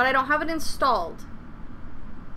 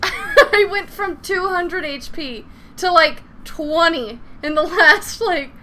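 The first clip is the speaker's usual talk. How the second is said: laughing; 5 semitones higher, 5 dB louder, slower than usual. Real, not acted. Laughing relief after near death.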